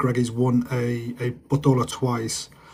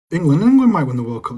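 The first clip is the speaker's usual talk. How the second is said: raised, louder hype, England repeated; excited; 4 semitones higher, 5 dB louder than usual. Real, not acted. Streamer hyping England's World Cup chances to chat, excited. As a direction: sell it like a bold prediction.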